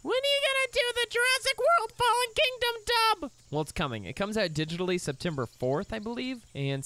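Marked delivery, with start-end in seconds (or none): 0.0-3.3 much higher, louder whiny fan voice; mock the eager commenter; mimicking a fan. Creator imitating fans asking about the dub, high whiny voice. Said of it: "I get the comment all the time".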